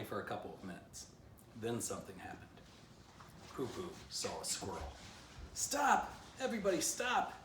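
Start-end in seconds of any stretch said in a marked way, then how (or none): 5.5-7.3 high, loud yell to halt everyone. A narrator's reading. Poo Poo yelling at the pack to stop, loud and high. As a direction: shout it out sharply, skidding to a stop. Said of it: "yelled Poo Poo".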